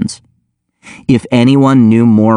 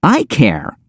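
higher-pitched, indignant retort; shooting back; 4 semitones higher than usual. A narrator's reading. Narrator defending his hurt nose to Abigail, shooting back. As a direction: answer back, mildly stung. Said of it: "I shot back".

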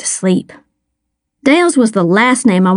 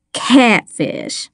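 "Catfish" landed with a falling, satisfied tune; beaming. A narrator's reading. Miss Lana accepts "Catfish", beaming, tune falling. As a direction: beam on "Catfish" as the answer found.

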